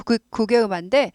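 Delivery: ordinary talk, nothing marked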